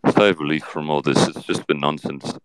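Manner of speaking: Johnny Depp. Acted. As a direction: answer low with thoughtful pauses. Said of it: "Johnny Depp is on the show".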